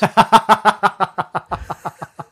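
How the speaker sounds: long high burst of laughter; real helpless laugh. Real, not acted. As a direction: crack up at your own punchline. Cracks up at own punchline.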